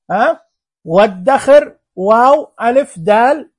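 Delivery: normal talking voice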